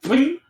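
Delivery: high, ringing little pling; imitated pling. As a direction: make the ping of a dart ricocheting. Imitating a dart bouncing off.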